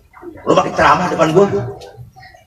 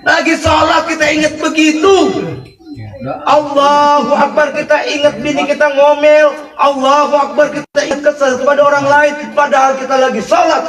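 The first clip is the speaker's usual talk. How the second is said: preaching at full force; 10 semitones higher than usual. Real, not acted. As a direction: preach it out to the whole hall.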